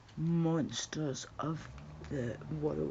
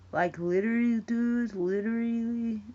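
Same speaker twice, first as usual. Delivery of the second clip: put-on higher, louder girly "Literally"; mock valley girl; 5 semitones higher, 6 dB louder than usual. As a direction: ham up the gushing "Literally". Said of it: "why do lassies say that?".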